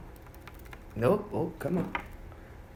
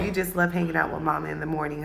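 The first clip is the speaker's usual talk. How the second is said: usual speaking voice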